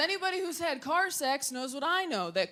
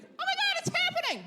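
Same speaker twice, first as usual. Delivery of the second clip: excited toy. Retold or imitated. Stuffed animal in claw machine.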